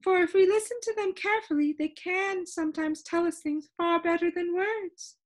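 high-pitched, lively storybook shopkeeper; high voice of a proud expert; Soundkeeper character. A narrator's reading. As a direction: explain it eagerly in a high character voice.

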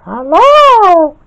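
very high, loud villain squeal; drawn-out piercing falsetto greeting; Evil Spinel. A narrator's reading. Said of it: "said Evil Spinel".

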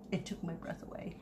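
barely whispered, awestruck; stunned whisper, voice almost gone; breathless whisper. Real, not acted. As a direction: let the words slip out as a hushed whisper. Overcome seeing valuable china.

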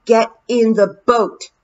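loud, slow urgent shout. Acted. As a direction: yell it while dragging him. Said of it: "Tiffany shouted".

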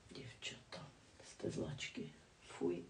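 very soft, voiceless aside; whispered scolding. Real, not acted. Vlogger chiding her girl viewers, whispered. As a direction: whisper a little telling-off.

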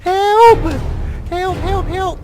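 high, loud cries of help; hammed-up panicked shouts for help; mock cries for help. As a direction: call for help like a drowning man. Surrounded by sharks.

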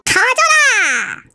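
spell shout. Acted. Recording a grow-spell game line.

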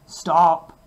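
loud sharp command; sleepy ogre stopping the harp, shouting; shouted. A narrator's reading. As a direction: snap the order. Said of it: "he shouted".

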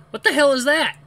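loud, high startled cry; startled shout. Acted. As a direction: jump at the noise and yell.

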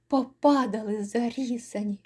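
usual speaking voice

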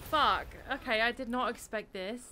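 loud stunned swear; shocked outburst. Real, not acted. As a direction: blurt it out in shock. Shocked reaction to trailer.

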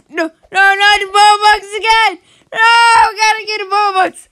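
high, loud wailing cry; desperate yell. Real, not acted. Player howling at the game as Robux vanish, loud high cry. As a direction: shout as if the prize is escaping.

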